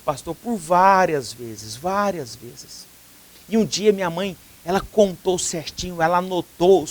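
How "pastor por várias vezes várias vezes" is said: slow confession. Acted. Confession, slowed for weight.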